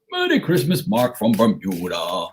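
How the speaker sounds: imitating the Bermudian Santa on TV. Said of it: "That's exactly how he used to talk".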